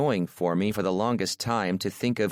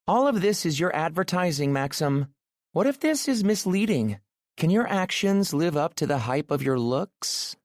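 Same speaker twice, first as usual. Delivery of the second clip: much higher, playful worried woman; teasing woman's voice; 9 semitones higher than usual. A narrator's reading. Aurora jokes with Maxim about being bad in bed, high and playful. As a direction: tease him in a high voice.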